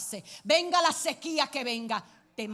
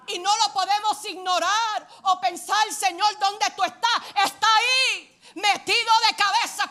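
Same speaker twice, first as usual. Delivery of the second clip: higher and much louder, building fervor; preaching at full force; 6 semitones higher, 9 dB louder than usual. Real, not acted. Preacher assuring the congregation God is near, fervent. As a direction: climb to a passionate preaching peak.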